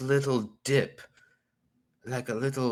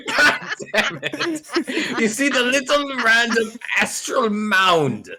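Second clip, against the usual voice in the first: high, laughing as the words come out; exasperated laughter; 9 semitones higher, 4 dB louder than usual. Real, not acted. Player pointing out the little astral mound, exasperated and laughing. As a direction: plead helplessly through laughter.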